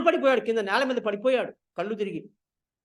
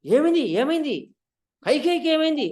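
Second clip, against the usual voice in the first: anxious call, rising and louder; calling out alarmed; 3 semitones higher, 4 dB louder than usual. Acted. King calling out for his queen, alarmed. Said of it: "అని అరుచుకుంటూ".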